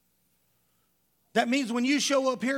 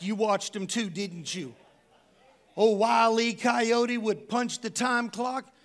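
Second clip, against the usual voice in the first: ordinary talk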